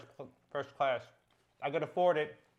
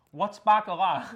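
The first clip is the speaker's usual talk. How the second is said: raised, louder quoted question; curious woman's question; 4 semitones higher, 6 dB louder than usual. Retold or imitated. Retelling a girl coming up to ask, mock curious. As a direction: lightly voice a stranger asking what it means. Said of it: "she's like".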